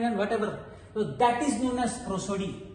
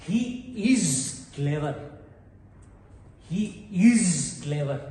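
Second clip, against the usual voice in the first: lower, slow firm statement; affirmative statement; 5 semitones lower, slower than usual. Shown as a demonstration. Teacher showing an affirmative statement, slow and low. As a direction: state it firmly and slowly. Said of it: "this is an affirmative statement".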